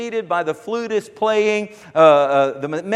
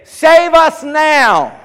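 high, loud, slow cry of the crowd; crowd shout; 7 semitones higher, 6 dB louder, much slower than usual. Retold or imitated. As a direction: call out like worshippers at the altar. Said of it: "they would shout".

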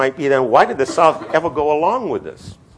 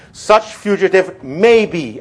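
"may be" drawn out, raised and firm; stress on may be; 6 semitones higher, 4 dB louder, slower than usual. Shown as a demonstration. Lecturer quoting the clause to class, emphatic on "may be". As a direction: read slowly and land hard on "may be". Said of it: "I want to emphasize the word may be".

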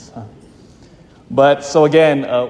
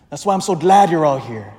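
ordinary talk, nothing marked

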